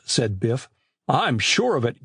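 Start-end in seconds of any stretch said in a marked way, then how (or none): none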